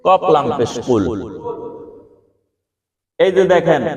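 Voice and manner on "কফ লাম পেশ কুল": lower, chanted spelling কফ লাম পেশ কুল, letter by letter. Shown as a demonstration. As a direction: recite the Arabic letters like a classroom drill. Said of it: "সূরা ইখলাসের শুরুতে কি এরকম আছে?".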